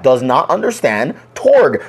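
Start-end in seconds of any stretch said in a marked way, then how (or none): 1.3-1.8 shouted word. Real, not acted.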